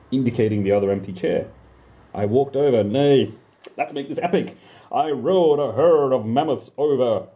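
ordinary delivery, nothing marked

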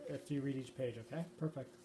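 ordinary delivery

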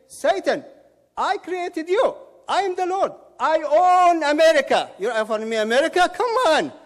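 high, loud, scornful rebuke; acted indignant outburst. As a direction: thunder at an upstart as his maker. Acting Lord crushing Satan.

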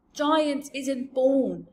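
big friendly giant, slow and booming; rumbling kindly giant; BFG voice. A narrator's reading. BFG character voice.

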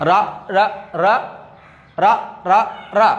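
রা in short, separate, even beats; letter sound drill. Shown as a demonstration. Teacher shows how R is pronounced, sound drill. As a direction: sound out রা beat by beat. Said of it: "এটার মতো উচ্চারণ হবে".

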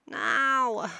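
loud impatient order; sharp loud command. Real, not acted.

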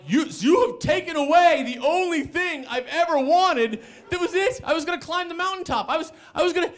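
very high, wailing tirade; anguished outrage. Retold or imitated. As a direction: wail at the one who ruined it. Lamenting to roommate.

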